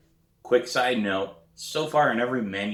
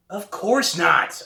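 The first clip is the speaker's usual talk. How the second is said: indignant retort; 11 semitones higher, 5 dB louder, much slower than usual. A narrator's reading. Narrator answers brother.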